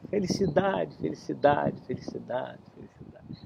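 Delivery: eco que desaparece em sussurro; eco sumindo. Acted. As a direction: deixe a palavra morrer aos poucos. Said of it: "Agora grita". (a floresta devolve felicidade como eco que se apaga)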